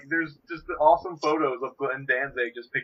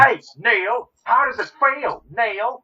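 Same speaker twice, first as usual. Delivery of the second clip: much higher, louder mocking British taunt; Rick from The Young Ones; 9 semitones higher, 6 dB louder, much slower than usual. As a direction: sneer it like a psychotic student poet. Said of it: "Rick Mayhall from The Young Ones".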